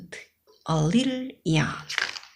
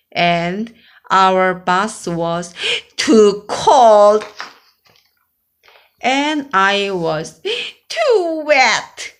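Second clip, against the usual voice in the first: whiny complaints; 7 dB louder, slower than usual.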